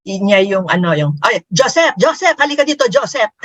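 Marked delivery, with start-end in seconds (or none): 1.2-3.3 put-on Pinoy mom accent. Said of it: "ginagaya-gaya".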